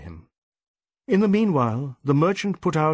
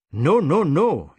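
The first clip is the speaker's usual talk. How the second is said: loud, furious denial; sudden fury; 3 semitones higher, 5 dB louder, slower than usual. Acted. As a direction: snap it out in anger. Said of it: "snapped in sudden fury".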